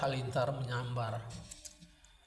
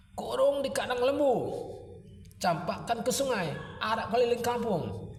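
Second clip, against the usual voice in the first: high-pitched mob calls; villagers' jeering demands; 8 semitones higher than usual. A narrator's reading. Villagers demanding the couple be punished, high heckling calls.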